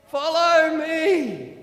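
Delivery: slow, loud, drawn-out leader's call; slow Mister T call. Acted. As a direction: stretch each word like a wise old guide. Mister T leads the cars.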